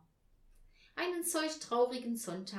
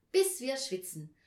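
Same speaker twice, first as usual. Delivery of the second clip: raised, louder exclamation; eager calling out; 5 semitones higher, 7 dB louder than usual. A narrator's reading. Anja caps her plan for the stove, eager calling out. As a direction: call it out with relish. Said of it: "rief Anja".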